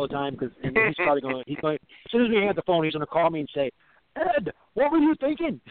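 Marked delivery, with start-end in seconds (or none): 4.2-5.6 mock Australian accent, much higher and slow; [name]'s Australian accent. [name] phoning Ed after the show, mock Australian accent. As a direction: tease a friend with a high, drawn-out mock Australian voice. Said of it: "with that accent".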